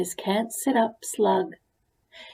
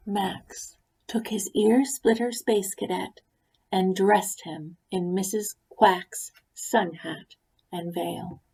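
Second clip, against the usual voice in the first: plain reading voice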